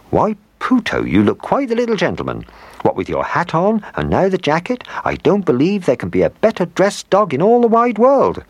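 high, gushing fondness; loving admiration. A narrator's reading. Old woman admires her dressed-up dog, high and doting. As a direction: dote on him in a high voice. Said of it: "looked at Puto very lovingly".

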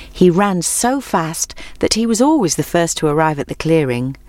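usual speaking voice

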